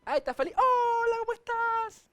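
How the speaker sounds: mocking the falsely friendly churchgoer; fake sweet hypocrite. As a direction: ham up a phony cheerful hello. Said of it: "tiene que ser hipócrita".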